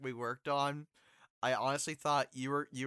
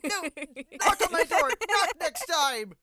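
higher, louder flustered mother; high mom voice; 13 semitones higher, 5 dB louder than usual. Acted. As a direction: fuss like a caught-out mom. Mother scolding son.

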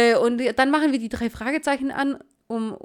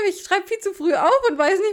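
usual speaking voice

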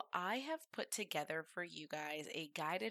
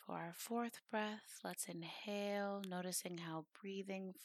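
soft, measured meditation voice with long pauses; guided meditation; 5 dB quieter than usual. Acted.

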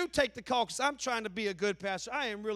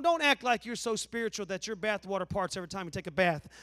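ordinary delivery

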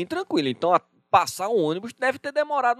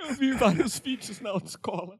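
giggling; 4 semitones higher, 5 dB quieter than usual. Real, not acted. Laughing reply.

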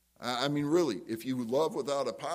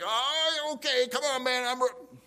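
high, loud mock exasperation; riled-up retort; 11 semitones higher, 4 dB louder than usual. Person being fussed at, riled and loud. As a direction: act provoked and ready to fight. Acting someone riled into fighting back.

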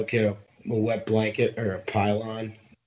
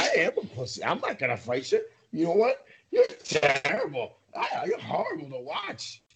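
mocking chirp; 10 semitones higher than usual. Retelling PK taunting Zadorov, high sneering mock. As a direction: needle him in a high taunting sing-song. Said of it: "He's like".

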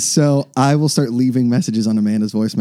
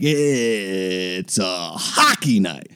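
slow, drawn-out hockey announcer call; Mike Lang impression; much slower than usual. Imitating the Penguins announcer's slow arena call. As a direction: stretch it like a big arena intro. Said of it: "Mike Lang does this thing where he's like".

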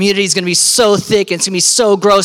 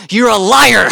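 loud, punched mock accusation; higher, louder, jokey outrage; 3 semitones higher, 5 dB louder, slower than usual. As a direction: call them out, playfully indignant. Teen accuses the preacher.

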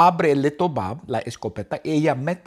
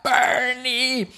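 warden yelling; 11 semitones higher than usual. Acted. Warden shouting after escaping Bernie, high yell. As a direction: call after the escaping prisoner. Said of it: "y Yan Lee grita".

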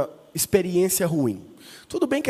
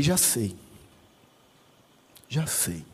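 scheming murmur; 8 semitones lower, 8 dB quieter, slower than usual. Retold or imitated. He hatches a sneaky plan.

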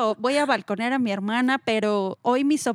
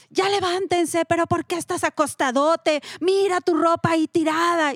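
higher, sharp morning scolding; scolding sister; 6 semitones higher, 3 dB louder than usual. Retold or imitated. Sister getting her grown sons out of bed, higher scolding. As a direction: roust the lazy sons, nagging. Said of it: "pero mi hermana los levanta".

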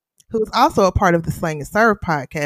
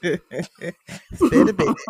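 said through a laugh; chuckling while saying it; 2 semitones higher, much slower than usual. Real, not acted. Repeated line, laughing first.

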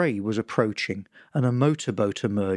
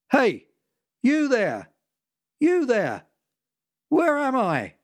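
demanding boater; 10 semitones higher than usual. Acted. Lost power boater yelling a demanding question. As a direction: shout down at the little boat, lost and annoyed. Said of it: "he demanded".

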